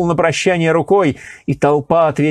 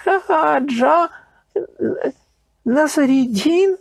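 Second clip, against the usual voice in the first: high, slow, halting question; mumbled in shock; 11 semitones higher, much slower than usual. A narrator's reading. Emir dumbfounded at the unmasking, halting mumble. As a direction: barely get the name out. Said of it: "невнятно вымолвили".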